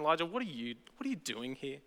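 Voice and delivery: soft, hushed coaxing question; gentle calling out. Shown as a demonstration. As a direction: call him out very softly. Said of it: "calling him out of".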